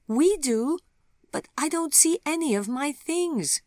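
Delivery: put-on high, loud little girl; child's high, loud complaint; small child.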